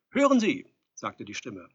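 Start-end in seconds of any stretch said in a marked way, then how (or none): 0.1-0.7 high, loud bid for attention; calling through door. A narrator's reading. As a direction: call out to be heard inside. Visitor calls through the door.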